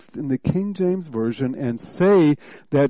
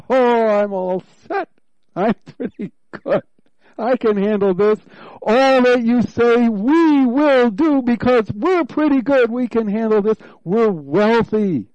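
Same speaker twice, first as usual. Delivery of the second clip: higher, louder smug boasting voice; smug church; 6 semitones higher, 4 dB louder than usual. Acted. Preacher mocking the complacent church, smug and loud. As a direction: puff up like a self-satisfied congregation. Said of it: "Because thou sayest, 'I am rich.'".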